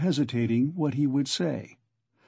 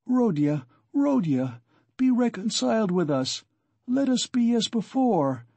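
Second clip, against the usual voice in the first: mother's high pleading cry, rising as she begs; 9 semitones higher than usual. A narrator's reading. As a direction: beg him to come back, crying out. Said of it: "cried his poor mother".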